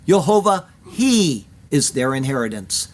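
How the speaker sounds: pause, then stress "he", dropping away afterwards; emphasis on he. Shown as a demonstration. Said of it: "an emphasis which is missing in the translation".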